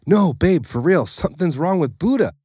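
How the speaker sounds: insisting frantically; panic. A narrator's reading. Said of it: "Deluxe said in a panic again".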